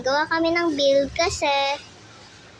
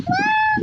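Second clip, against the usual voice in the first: high startled yelp. Real, not acted.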